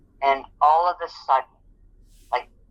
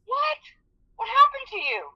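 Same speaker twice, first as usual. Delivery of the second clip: much higher, startled double what; shocked supervisor. Retold or imitated. Supervisor hears her worker can't move, shocked. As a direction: gasp it out in disbelief.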